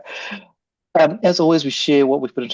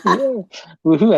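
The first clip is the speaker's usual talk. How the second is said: higher, louder little cheer; excited cheer; 9 semitones higher, 7 dB louder, slower than usual. Real, not acted. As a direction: echo her woohoo with glee. Echoing guest's cheer.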